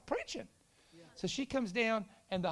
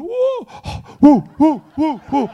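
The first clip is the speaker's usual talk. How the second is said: loud, high celebratory whoops with gasps; screaming whoops; 7 semitones higher, 19 dB louder, slower than usual. Retold or imitated. Kids jumping on chairs at breakup news, imitated screaming whoops. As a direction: whoop repeatedly, imitating wildly cheering kids. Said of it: "started screaming".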